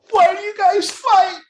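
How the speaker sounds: loud, very high mock scream, drawn out; hammed-up mom screeching at everyone; shrieking mom. Mom blaming everyone at dinner, acted high shriek.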